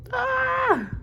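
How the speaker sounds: sudden high yelp of shock; startled high yelp at a plot twist; shocked cry. Real, not acted. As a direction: let out a sharp high cry. Shocked reaction while reading.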